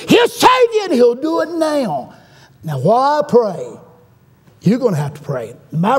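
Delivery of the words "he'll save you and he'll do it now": high, ringing preacher's cry; preacher's climactic cry. Retold or imitated. Preacher urging a woman to be saved, high impassioned cry. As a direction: lift it high and drive it home.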